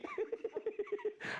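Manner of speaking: high-pitched giggle; laughing. Real, not acted. Host not saying what he thinks, giggling. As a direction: let out a high run of giggles.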